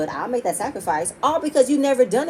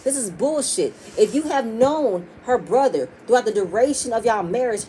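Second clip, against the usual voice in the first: usual speaking voice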